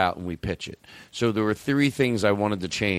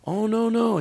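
friend reassuring; 10 semitones higher, slower than usual. Retold or imitated. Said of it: "He's like".

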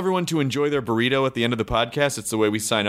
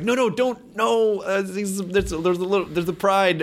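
high-pitched protest at the character; mock alarmed pleading; 7 semitones higher, slower than usual. Interviewer pleading with the character over his mistakes, mock alarmed. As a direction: warn him off in a higher, urgent voice.

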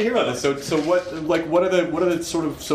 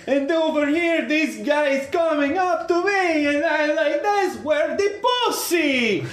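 high, slow Russian accent imitation; a slow Russian open-mic comic accent; Dmitry's Russian accent; slower than usual. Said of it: "he would really hit that word hard".

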